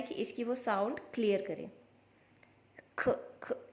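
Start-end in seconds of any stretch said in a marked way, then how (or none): 3.0-3.7 breathy unvoiced ख ख, no vowel; just the puff of ख, not खा; whispered consonant sound ख; soft k sound. Shown as a demonstration. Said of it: "ऐसी sound निकालना है".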